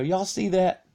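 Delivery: high excited call of alarm. Retold or imitated. To friends in the car, urgent call.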